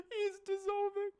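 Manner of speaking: high, near-crying laughter; wheezing the words out between laughs; laughing helplessly. Real, not acted.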